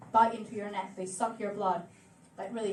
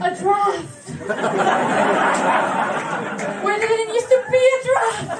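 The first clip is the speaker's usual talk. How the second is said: higher, louder hammed-up indignation at a draft; mock British outrage; 7 semitones higher, 9 dB louder than usual. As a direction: play the affronted Brit, over the top. Acting outraged ghost victim.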